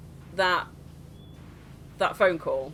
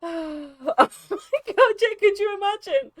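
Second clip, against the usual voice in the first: high-pitched, through laughter; laughing; 11 semitones higher, 5 dB louder than usual. Real, not acted. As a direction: gasp it out while cracking up. Cracking up at own joke.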